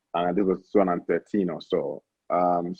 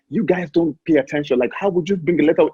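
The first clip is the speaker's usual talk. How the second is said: imitating a scolding official, pitched well higher; mock scolding yell; 7 semitones higher than usual. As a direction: retell his rant as a mild mock scream, raised. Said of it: "he was screaming at me".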